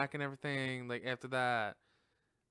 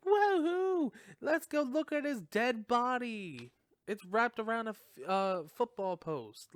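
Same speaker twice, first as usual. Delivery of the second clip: high, loud cheering woohoo; mock cheering, high and slow; mock excited crowd; 8 semitones higher, 3 dB louder than usual. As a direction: ham up the gleeful gawkers.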